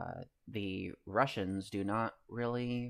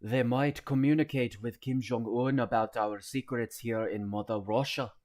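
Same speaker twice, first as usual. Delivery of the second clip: louder, hammed-up Russian soldier voice; cringey Russian accent; 2 semitones higher, 6 dB louder than usual. Streamer voices fearful Russian soldier, hammed-up Russian accent. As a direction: overdo a paranoid Russian soldier. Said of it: "It's cringe".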